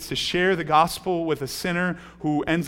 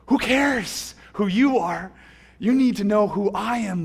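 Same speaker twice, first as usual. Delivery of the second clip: higher, louder, slow commanding voice; God's firm answer; 5 semitones higher, 6 dB louder than usual. Acted. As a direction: proclaim it as God, drawing it out.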